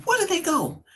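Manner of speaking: sudden high panicked cry; frantic. Retold or imitated. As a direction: retell the panic, pitch jumping way up. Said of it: "but I'm frantic".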